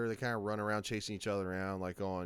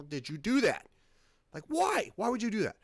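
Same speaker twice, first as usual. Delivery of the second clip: higher, louder mock outcry; exasperated disbelief; 10 semitones higher, 5 dB louder than usual. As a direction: demand an answer like a baffled fan. Voices exasperated audience questioning director.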